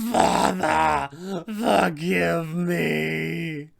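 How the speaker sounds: slow, crying plea to his father; dying cry. Acted. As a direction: cry out for forgiveness as you fall, very drawn out. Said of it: "he will scream out".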